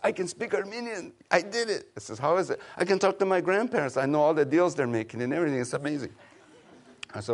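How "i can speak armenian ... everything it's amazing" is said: put-on quoted kid. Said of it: "and I said".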